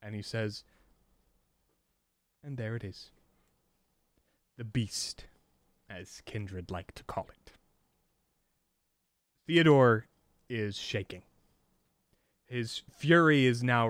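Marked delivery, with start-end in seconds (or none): 2.4-7.5 calm. Acted.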